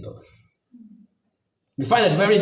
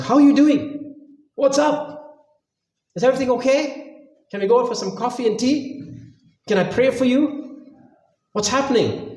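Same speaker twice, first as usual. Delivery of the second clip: caring friend on phone; 6 semitones higher than usual. Retold or imitated. Voiced caring phone call.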